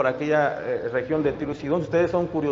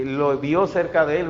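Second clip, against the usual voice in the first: normal talking voice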